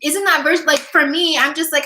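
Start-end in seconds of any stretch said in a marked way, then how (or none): none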